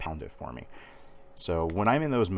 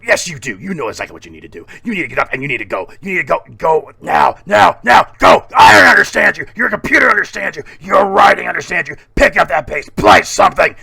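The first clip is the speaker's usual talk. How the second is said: screamed pep talk building to full bellow; relentless shouting, high and loud; 9 semitones higher, 16 dB louder, faster than usual. Acted. Miniature motivator screaming on his shoulder, furious shout. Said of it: "screaming at me constantly".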